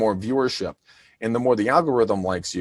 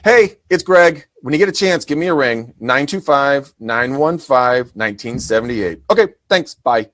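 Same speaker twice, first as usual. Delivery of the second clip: higher, louder upbeat quick message; casual voicemail; 4 semitones higher, 5 dB louder than usual. Retold or imitated. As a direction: leave a breezy friendly voicemail. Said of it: "this is my message now, here it is".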